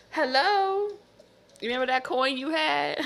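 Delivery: high taunting call. Retold or imitated. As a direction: tease the thief in a high voice. Taunting the thief.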